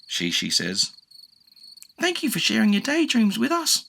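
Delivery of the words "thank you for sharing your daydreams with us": high sincere thanks; grateful bird. A narrator's reading. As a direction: say it warmly. Shishi voice.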